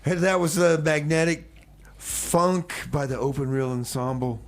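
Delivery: ordinary talk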